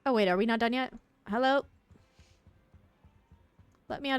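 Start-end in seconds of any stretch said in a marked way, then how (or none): none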